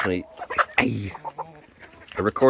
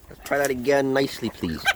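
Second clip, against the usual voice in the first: normal talking voice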